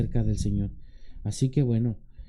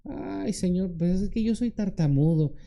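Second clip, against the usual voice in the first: higher-pitched whiny excuse; reluctant, higher-voiced complaint; Moses making excuses; 5 semitones higher than usual. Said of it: "aquel que pone pretextos como Moisés".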